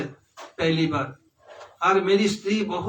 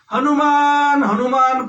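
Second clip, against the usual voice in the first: high, loud call across a distance; mother calling her son from afar; 7 semitones higher, 6 dB louder, slower than usual. Acted. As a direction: call his name out loud.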